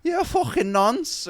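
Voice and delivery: high, loud, drawn-out crowd-work jibe; Paul Smith. Retold or imitated. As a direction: imitate a comic slowly jeering at a punter. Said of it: "like, an impression of, um, Paul Smith".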